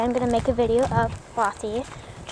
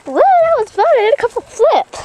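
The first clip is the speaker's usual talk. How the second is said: trampoline; 11 semitones higher, 9 dB louder than usual. Real, not acted. Shouting while bouncing.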